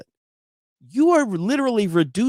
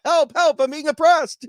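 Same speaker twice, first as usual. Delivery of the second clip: high, loud mock-oppressed peasant; loud, high hammed-up cry for help; 10 semitones higher, 5 dB louder than usual. Monty Python quote.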